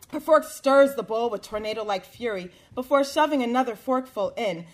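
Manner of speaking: ordinary delivery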